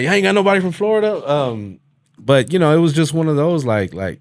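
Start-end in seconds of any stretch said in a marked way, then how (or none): 0.0-1.3 loud, fast teasing jab; high, loud taunting voice; mocking taunt. Quoting taunting comments again.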